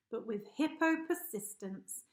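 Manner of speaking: ordinary delivery, nothing marked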